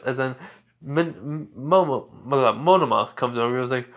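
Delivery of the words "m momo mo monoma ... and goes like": plain reading voice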